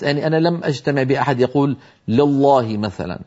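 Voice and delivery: usual speaking voice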